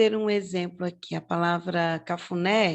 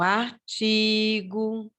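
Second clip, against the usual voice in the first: "artigo" slowly with a long TI; stressed syllable drawn out; much slower than usual. Shown as a demonstration. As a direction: lean on the TI in "artigo". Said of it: "na penúltima sílaba".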